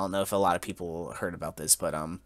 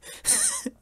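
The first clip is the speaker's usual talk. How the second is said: helpless laugh; 6 dB quieter than usual. Real, not acted. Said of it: "it sounds like I'm laughing".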